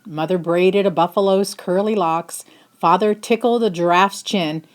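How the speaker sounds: plain reading voice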